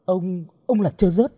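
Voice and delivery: high, stammered shock; horrified question. A narrator's reading. Girl realises who her captor is, horrified question. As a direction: ask haltingly in horror. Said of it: "Hồng kinh hoàng hỏi".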